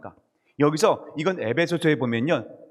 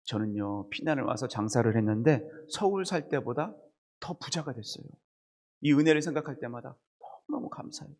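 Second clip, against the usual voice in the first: elder's testimony; 5 semitones lower, 8 dB quieter than usual. Retold or imitated. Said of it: "한 장로님이 이렇게 고백을 했대요".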